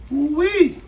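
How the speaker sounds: high, loud, drawn-out whoop; thrilled holler over good food; excited whoop. Real, not acted. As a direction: let out a big high squeal of delight.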